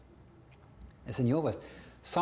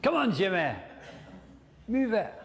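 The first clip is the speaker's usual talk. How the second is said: mock rousing shout; 6 semitones higher than usual. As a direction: holler to get him moving. Acts rousing oneself, echoing a participant.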